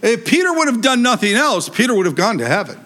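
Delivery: normal talking voice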